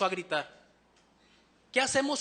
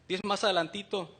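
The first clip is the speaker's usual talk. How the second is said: usual speaking voice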